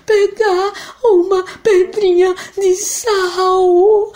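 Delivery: menino agudo chorando, lento; crying boy. Acted. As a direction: responde soluçando. João chora. (menino chorando)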